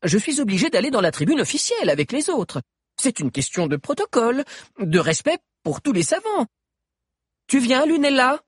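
put-on high, earnest young man; eager, slightly pressed young scientist. Liu voiced by narrator. (voix aiguë de jeune savant)

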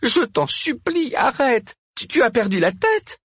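very high, distressed begging; moaning plea. A narrator's reading. Cosima begging Camille to stop, moaning. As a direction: wail at him to stop. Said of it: "gémit Cosima".

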